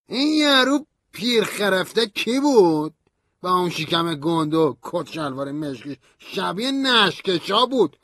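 put-on high, slowish sneering young man; Stanley's mocking voice. A narrator's reading. Stanley mocks narrator's father-in-law, high sneering voice. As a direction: jeer at the old man like a drunk friend.

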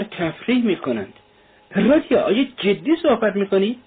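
high-pitched disbelieving questions; incredulous protest. A narrator's reading. Razumikhin challenging both friends for joking, incredulous. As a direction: protest as if they must be joking.

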